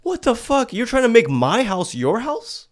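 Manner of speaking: hammed-up disbelief at her nerve; indignant outburst. Mocking the guest who took over his house, hammed-up outrage. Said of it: "It's like".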